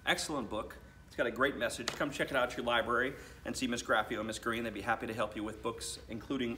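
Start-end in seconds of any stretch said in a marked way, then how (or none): none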